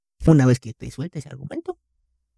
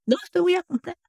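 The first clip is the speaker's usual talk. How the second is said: much higher mock mother's refusal; refusing mom; 3 dB quieter than usual. Son imitating mom refusing to buy an Xbox, high pitched. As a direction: squeak out mom's flat no. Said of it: "O cuando le pedías algo".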